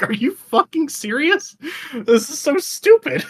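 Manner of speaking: high, slow incredulous whine; exasperated. Retold or imitated. Stuck carrying the princess, high slow disbelief. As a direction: drag it out in disbelief. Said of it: "I was just like".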